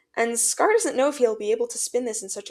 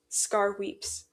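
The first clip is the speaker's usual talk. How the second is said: ordinary delivery, nothing marked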